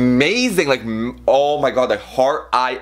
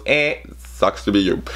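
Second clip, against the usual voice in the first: lightly voiced Ashton; 3 semitones lower, slower than usual. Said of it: "And then Ashton goes".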